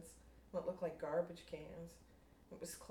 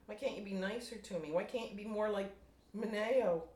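louder pleading cries; hurt shouting at the sky; mild acted yell, rising to Mineo; 4 semitones higher, 7 dB louder than usual. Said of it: "she yelled".

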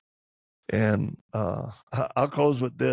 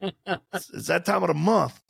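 playful higher voice, ready to brawl; mock wife in fight mode; 6 semitones higher than usual. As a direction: ham up a wife spoiling for a fight.

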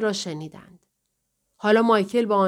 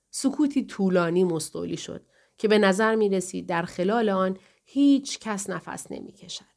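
ordinary delivery

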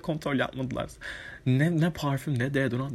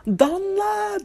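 high, loud call of a name; shouted name; 6 dB louder than usual. Retold or imitated. Fan shouting Danla from the box, loud call. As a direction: yell her name across the crowd.

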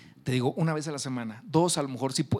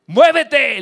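furious bellowed command, much higher and louder; shouted order; 13 semitones higher, 17 dB louder than usual. Retold or imitated. Captain yelling at the lighthouse to move, full shout. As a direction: yell the order like an offended captain. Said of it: "le grita".

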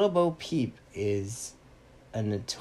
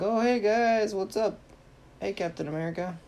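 high-pitched, cheery hello on a call; breezy greeting; 11 semitones higher, 4 dB louder than usual. Retold or imitated. Sensei answering the heroes' video call, breezy greeting. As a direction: greet the team brightly, voice lifted.

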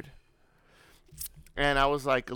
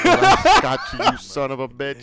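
gloating bee; 8 dB louder than usual. Pretend. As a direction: play a dying bee's last taunt. Voicing the dying bee gloating.

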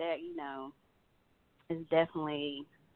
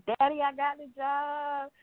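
higher, slower, overjoyed cry to her father; excited crying out; 9 semitones higher, slower than usual. Retold or imitated. Daughter telling her father she got the job, higher and thrilled. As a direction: burst out the news to dad, thrilled. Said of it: "I was screaming and crying".

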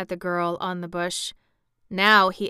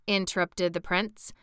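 usual speaking voice

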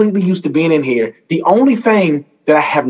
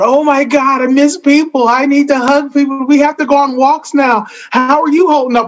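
higher, anxious voice of people missing company; mock worried neighbour, pitched up; mock panicky friends; 7 semitones higher than usual. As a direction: gush like someone desperate for a hug. Voicing anxious lockdown people.

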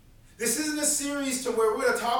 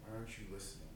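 fading plea; 6 semitones lower, 14 dB quieter than usual. Acted. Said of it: "go to God and say".

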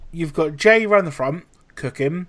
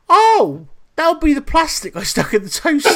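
higher and louder, mock-innocent coworker voice; imitating Gav; 10 semitones higher, 6 dB louder than usual. Coworker owning up to the chef, mock-innocent and high. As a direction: play Gav's cheerful confession, pitched up. Gav admits plastic in toaster.

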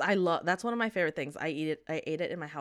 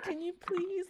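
mock timid whisper; 12 semitones higher, 6 dB quieter than usual. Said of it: "you're whispering".

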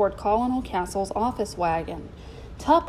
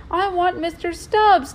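put-on high, loud childlike wail; sobbing plea; 9 semitones higher, 5 dB louder than usual. A narrator's reading. Boy begs for his lost pet chimp, sobbing. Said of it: "Toby sobbed".

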